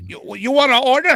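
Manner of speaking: high, very loud imitation of a Chinese accent; mock Chinese accent. One restaurant asking the other to order, loud mock Chinese accent. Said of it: "Chinese restaurant calling the other Chinese restaurant".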